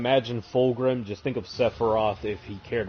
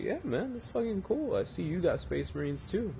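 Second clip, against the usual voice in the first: much higher, softer, lightly voiced local; high mock local voice; 8 semitones higher, 7 dB quieter than usual. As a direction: pitch it way up, dude-like and casual. Locals boasting about their marines.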